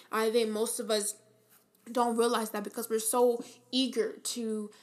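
normal talking voice

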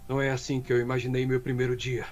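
low, composed character read; young Leon. Acted. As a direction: deliver it as a dubbed game line. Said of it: "pedindo pra você fazer o".

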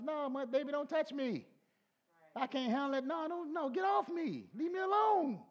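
high, rising to shouted refusal; mock panicked young spouse pleading no; fending off spouse. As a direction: push your partner away, laughing alarm. Young spouse refusing during fast.

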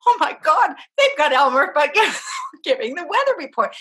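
high, loud, laughing through the words. Retold or imitated. Telling her husband in the dream, laughing hard. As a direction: gasp it out mid-laugh. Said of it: "I was laughing".

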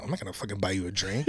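low, quiet, fast mutter. Retold or imitated. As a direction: mutter it under your breath. Retold inner thought, muttered.

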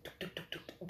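rapid whispered tuk-tuk heartbeat noises; voiceless ticking of a pounding heart; imitated heartbeat. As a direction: mimic a racing heart in quick, hushed taps. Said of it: "That's how fast my heart was".

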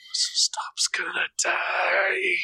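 hushed mock dread; whispering. Real, not acted. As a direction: fake despairing whisper.

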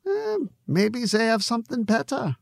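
Conrad the barkeep in a lightly put-on German accent; a loose, half-hearted German accent, friendly and teasing. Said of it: "for my German accent".

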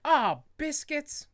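drawn-out polite stand-in curse; mock dismay. Dad swapping a swear for a cute word, mildly exasperated. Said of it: "he's like".

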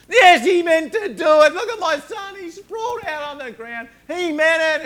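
very loud, high-pitched yelling at the ref; furious shouting father. Retold or imitated. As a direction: scream it like a dad whose son was flattened. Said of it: "The rage got up in him".

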